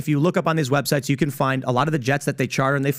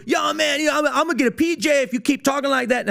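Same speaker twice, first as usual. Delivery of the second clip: much higher, hyped-up street-talk voice; 12 semitones higher than usual. Retold or imitated. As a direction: switch on a pumped showman persona for the camera. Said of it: "goes from this calm, lucid guy to".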